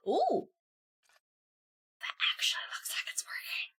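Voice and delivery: soft unvoiced ASMR whisper. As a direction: whisper it close to the mic. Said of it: "my swearing A S M R".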